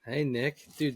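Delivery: usual speaking voice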